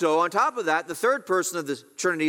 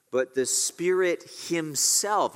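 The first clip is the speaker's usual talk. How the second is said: slow, with "Spirit himself" leaned on hard; stress on Spirit himself; slower than usual. Shown as a demonstration. Preacher pointing his listeners to the word in the verse, stressed. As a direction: draw out "But the Spirit himself" and press "Spirit". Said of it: "you see the emphasis there?".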